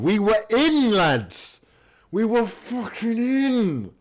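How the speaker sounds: high, drawn-out wail of frustration; anguished cry. Real, not acted. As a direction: cry it out like the chance was already a goal. Missed one-on-one chance, anguish.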